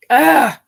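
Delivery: loud, high exhale of grief; tearful groan. Real, not acted. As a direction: let out a big emotional "ah". Overcome with tears while telling story.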